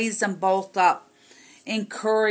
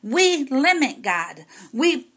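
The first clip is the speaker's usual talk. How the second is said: rising, louder pulpit preaching; fiery preaching; 4 semitones higher, 3 dB louder than usual. Acted. Preacher rebuking the congregation for limiting God, forcefully.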